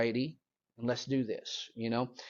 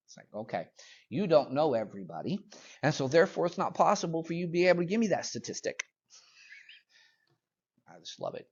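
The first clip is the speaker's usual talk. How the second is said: ordinary talk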